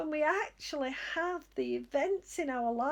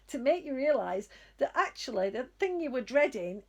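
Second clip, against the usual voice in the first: usual speaking voice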